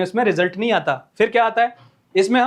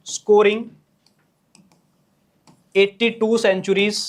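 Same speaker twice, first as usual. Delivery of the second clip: dictating slowly; much slower than usual. Shown as a demonstration.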